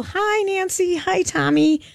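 high, sing-song cheerful hello; mock cheery greeting. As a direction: chirp it like overly happy staff. Mocking cheerful staff greetings.

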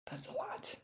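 whispered, under the breath; whispered aside. Real, not acted. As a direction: breathe it to yourself.

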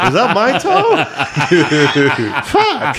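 high, laughing fake alarm; laughing mock panic. Riffing on a flying toe, a high laughing mock panic. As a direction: squeal it through laughter as a joke. Joking about losing a toe.

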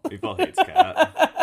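high, slow squeaky cat voice. Pretend. Voicing the cat.

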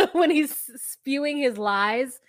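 talking through a laugh; words broken with real laughter. Real, not acted. Host laughing while recapping the boyfriend's lies. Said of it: "laughing while retelling".

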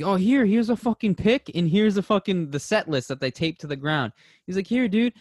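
high, loud, eager voicing; generous singer. Singer handing the fan a pick and set list, high eager voice. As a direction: hand it over like a pumped-up rocker.

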